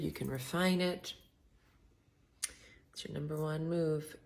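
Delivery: ordinary talk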